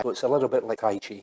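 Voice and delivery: usual speaking voice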